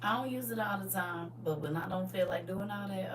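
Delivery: ordinary talk, nothing marked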